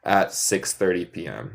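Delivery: slow, clear modeling; walk the class through it slowly; slow model sentence. Shown as a demonstration. Teacher modeling the plural form for students, slow. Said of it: "Pero todos los restos".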